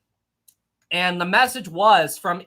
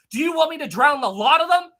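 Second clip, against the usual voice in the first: retold yell; 8 semitones higher, 4 dB louder than usual. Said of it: "basically yelled at him".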